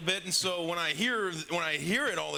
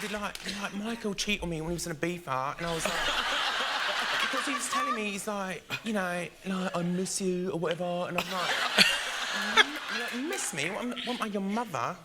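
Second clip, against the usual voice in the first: put-on Essex accent; 3 semitones higher than usual. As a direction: playfully gossip like a TOWIE cast member in an Essex accent. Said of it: "do their accent a little bit".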